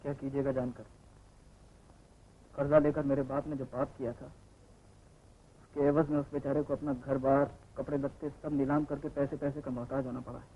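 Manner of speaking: नीचे सुर में, धीमी आवाज़ में दुख; पिता के पाप का बोझ दबे स्वर में बताओ; उदास स्वीकार. Acted. Somber confession of father's sin. (उदास, दबी आवाज़)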